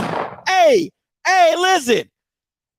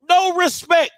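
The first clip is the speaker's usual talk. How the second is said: ordinary talk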